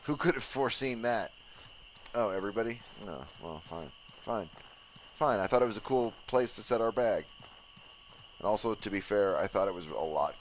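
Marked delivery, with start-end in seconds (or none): 3.0-4.5 much softer, lower sulky grumble; sulky mumble. Real, not acted. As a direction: mumble it to yourself, defeated. Sulking, muttered.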